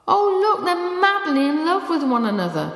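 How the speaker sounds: high, bright burst of delight; excited. A narrator's reading. Younger woman gushing at the two dogs, high and excited. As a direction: gush with sudden excitement over the dogs. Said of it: "voice had changed to one of excitement".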